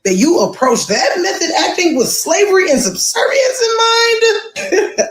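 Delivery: can't believe it, voice shooting up; incredulous. Real, not acted. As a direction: pitch climbing into high disbelief. Incredulous at the acting method.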